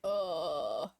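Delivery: very low guttural groan; deepest growl. Shown as a demonstration. Streamer showing chat the deepest note in their register. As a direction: drop as low as the voice goes. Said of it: "That's the deepest I can go".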